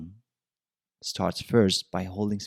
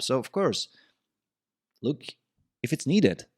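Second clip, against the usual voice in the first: usual speaking voice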